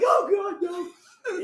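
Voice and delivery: high, mock-panicked cry; acted terror. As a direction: wail in hammed-up dread.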